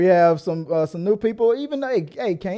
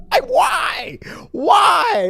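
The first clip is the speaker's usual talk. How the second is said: exasperated cry; 5 dB louder, much slower than usual. Acted. Exasperated crying out.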